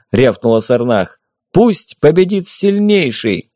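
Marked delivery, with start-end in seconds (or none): 1.5-3.5 proclamation. A narrator's reading. Toast before the fight.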